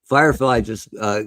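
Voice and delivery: ordinary delivery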